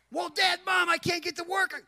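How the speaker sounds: high, loud mock whine of excuses; protesting son. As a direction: imitate a kid pleading his case to his parents. Son protesting being cut off.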